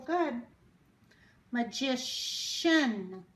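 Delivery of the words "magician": "Magician" said very slowly; slow demo word. Shown as a demonstration. Teacher presenting the word slowly. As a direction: stretch out "Magician".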